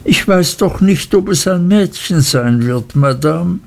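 high, guileless and innocent. A narrator's reading. Mother answers the aunt's question innocently. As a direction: answer wide-eyed, with no guile. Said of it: "sagte meine Mutter unschuldsvoll".

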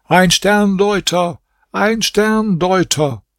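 high, slow, drawn-out cry; calling out again. A narrator's reading. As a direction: cry it out insistently to the crowd. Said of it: "rief zum dritten Mal".

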